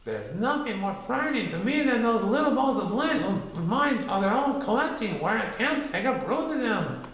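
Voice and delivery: high, fussy rabbit voice; fretful Rabbit. A narrator's reading. Rabbit confessing his fear of lint, high and fussy. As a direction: fret about the lint.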